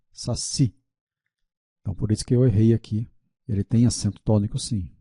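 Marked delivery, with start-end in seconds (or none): 0.1-0.8 saci with the stress on the final ci; stress on last syllable. Shown as a demonstration. Teacher showing the unwritten tonic accent, saci stressed on ci. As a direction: let the weight of saci fall on ci. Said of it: "a sílaba tônica".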